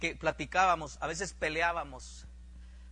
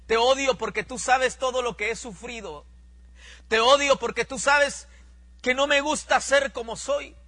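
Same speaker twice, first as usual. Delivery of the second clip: tearful complaint growing higher and louder; crying, building to a shout; 6 semitones higher, 9 dB louder than usual. Retold or imitated. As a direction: let the sobbing rage swell line by line. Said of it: "Y empecé a llorar". (reclamo entre lágrimas que sube a grito)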